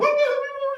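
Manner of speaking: high, loud imitated bawling; mock hysterical wail. His mom hearing the pregnancy news, exaggerated bawling wail. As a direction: imitate a mother bursting into wails. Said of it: "starts bawling, just hysterical".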